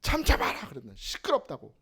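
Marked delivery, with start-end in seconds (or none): 0.0-0.7 sharp mock rebuke like driving out a demon; stern rebuke. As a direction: hush them as if casting out a devil. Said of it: "사탄 마귀 쫓는 것처럼". (마귀 쫓듯 꾸짖는 말투)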